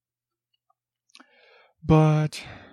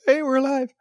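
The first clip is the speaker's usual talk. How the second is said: squeaky high mock cheer; jokey falsetto announcement; 12 semitones higher, faster than usual. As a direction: pipe it up high like a silly cartoon. Dead celebrities quoted, mock voice.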